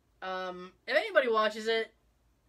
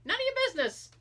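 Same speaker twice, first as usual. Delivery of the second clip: snippy retort; 7 semitones higher than usual. Pretend. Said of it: "It's like".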